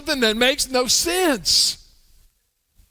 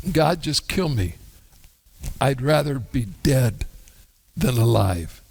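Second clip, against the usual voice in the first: despairing plea; 9 semitones lower than usual. Acted. Jonah's despairing prayer.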